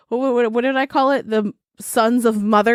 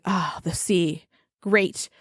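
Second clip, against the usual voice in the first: relieved sigh; 4 semitones lower, 6 dB quieter, slower than usual. Retold or imitated. Worm reaching the sea.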